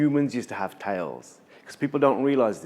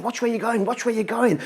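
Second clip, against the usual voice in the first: vexed creator; 9 semitones higher than usual. Acted. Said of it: "and he was saying".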